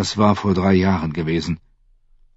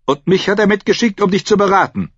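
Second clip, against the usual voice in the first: high, raised-voice rebuttal; vehement retort; 13 semitones higher, 4 dB louder, faster than usual. A narrator's reading. Priest uncle disputes his nephew's command, retorting sharply. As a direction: answer back forcefully, high and loud.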